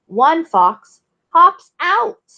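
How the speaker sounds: normal talking voice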